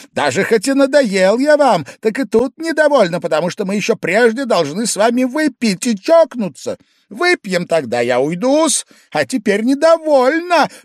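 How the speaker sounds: put-on high, loud drunken insisting; Pavel Pavlovich, insistent. A narrator's reading. Guest demanding to drink before leaving, high and insistent. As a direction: badger him in a high-pitched voice.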